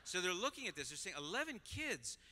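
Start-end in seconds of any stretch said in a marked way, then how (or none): 1.2-2.2 high-pitched, incredulous gossiping questions; mock disapproval. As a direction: imitate a shocked, negative onlooker. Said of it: "all the negativity".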